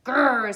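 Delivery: rhino growl. Acted. Rhinoceros growls at the small porcupine. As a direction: growl at him like a big rhino. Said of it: "said the rhinoceros".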